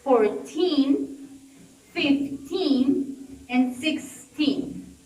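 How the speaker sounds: second syllable stressed in fourteen, fifteen, sixteen; stress on teen. Shown as a demonstration. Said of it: "with the numbers ending of teen".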